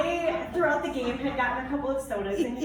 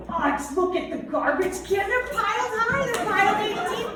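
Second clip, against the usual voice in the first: high, louder gushing over the garbage cans; mock excited; 9 semitones higher, 5 dB louder than usual. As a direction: gush like he found treasure. Imitating Dave's excitement at garbage.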